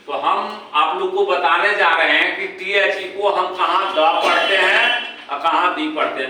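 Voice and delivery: ordinary delivery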